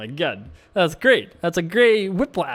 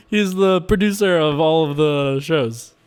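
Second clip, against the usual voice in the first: usual speaking voice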